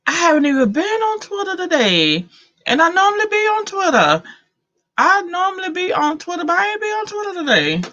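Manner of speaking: high, quick and worked up; excited disbelief. Real, not acted. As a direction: rattle it off, amazed you missed it. Surprised she missed the trending news.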